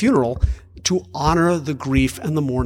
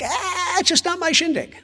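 high, airy dismissal, with a laugh; lightly laughing, high shrug-off; airy dismissive quote. Retold or imitated. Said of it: "I'm like".

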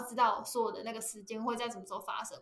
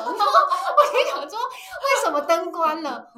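laughing, much higher and louder, slow; 8 semitones higher, 11 dB louder, slower than usual. Real, not acted. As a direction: giggle through a baffled question. Retelling the light prank, laughing.

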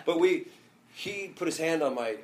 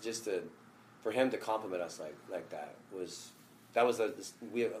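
ordinary delivery

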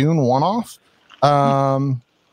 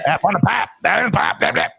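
a hammed-up East Coast accent, a touch higher; mock East Coast accent; 5 semitones higher, slower than usual. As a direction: toss off "pop" like a cartoon East Coaster. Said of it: "what everybody sounds on the East Coast".

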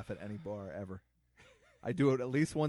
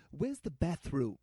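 slow, lofty, mildly haughty; snooty Gil voice; 5 semitones higher, 3 dB louder, slower than usual. Retold or imitated. Gil asking for the bathroom, slow and haughty. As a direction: drag it out like a stuffy butler. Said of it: "he was like".

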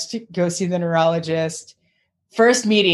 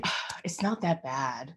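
a lightly voiced doctor, low and much softer, shrugging; dismissive doctor; 4 semitones lower, 12 dB quieter than usual. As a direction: play down the news, mock offhand. Doctor brushing off crying patient.

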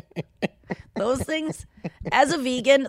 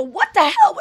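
startled exclamation; 9 semitones higher, 7 dB louder than usual. Retold or imitated. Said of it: "and I go".

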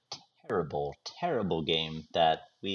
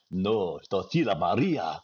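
telenovela drama. Pretend. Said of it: "like watching one of those telenovela".

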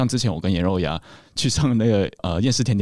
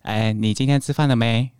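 put-on Mandarin with a Singapore-Malaysia accent; Singaporean Malaysian accent; 4 semitones higher than usual. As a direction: Malaysian Chinese reporter accent. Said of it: "这个是比较新加坡、新马的".

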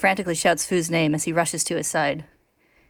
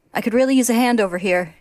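raised voice, calling across; 5 semitones higher, 4 dB louder than usual. A narrator's reading. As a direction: call out for a hand. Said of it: "to call out".

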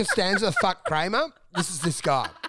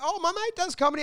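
much higher, chirpy voice; mock friends; 11 semitones higher than usual. Imitating friends bragging about their comedian mate, high and chirpy. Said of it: "and they were like".

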